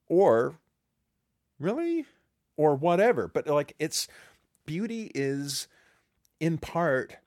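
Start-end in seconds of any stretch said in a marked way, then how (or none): 1.6-3.3 doubtful. Retold or imitated. Quoting listener's doubt.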